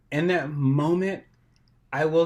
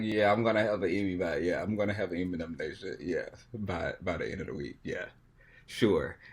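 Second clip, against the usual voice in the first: dry, quieter mock-confident brag; sarcastic; 6 semitones lower, 6 dB quieter than usual. Recalling the agent's call, sarcastically shrugging it off. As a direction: toss it off sarcastically, not believing it. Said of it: "like, sarcastic".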